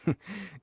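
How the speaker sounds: a short, quiet amused chuckle; soft amused laugh. Acted. Commissioner chuckles softly at Paimon's protest, amused. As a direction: laugh gently at the misunderstanding.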